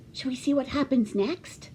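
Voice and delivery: ordinary delivery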